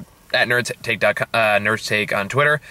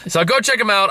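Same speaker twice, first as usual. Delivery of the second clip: usual speaking voice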